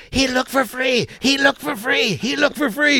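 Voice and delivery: high-pitched imitation of a heckler yelling; mock yelling. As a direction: cry it out like a street pest, over and over. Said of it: "he starts yelling".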